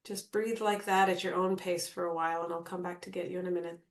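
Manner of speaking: calm meditation guide with long pauses; guided meditation. Acted. Guide settles the group into natural breathing, calm meditation voice. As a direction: ease them into stillness, leaving space between phrases.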